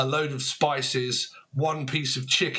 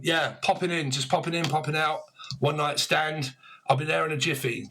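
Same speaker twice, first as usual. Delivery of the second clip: ordinary talk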